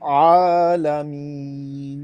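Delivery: drawn-out tajweed recitation for the student to copy; slow Quran recitation. Shown as a demonstration. Teacher recites slowly for the student to repeat. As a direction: recite slowly, stretching each vowel. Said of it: "اچھا جی، شروع کرتے ہیں".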